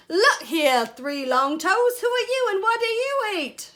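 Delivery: loud, high shouting frog; big brash storybook shout. Acted. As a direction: bellow it at a stranger, pitch climbing. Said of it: "shouted the wide-mouthed frog".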